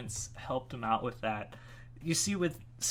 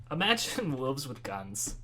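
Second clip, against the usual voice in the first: ordinary delivery, nothing marked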